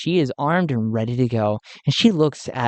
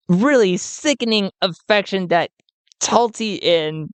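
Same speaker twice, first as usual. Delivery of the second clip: ordinary delivery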